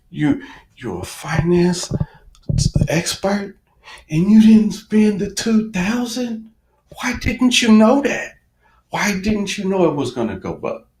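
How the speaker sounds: mock self-scolding. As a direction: needle yourself like a know-it-all friend. Berating himself in quote.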